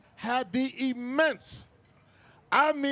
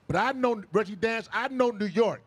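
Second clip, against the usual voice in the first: ordinary delivery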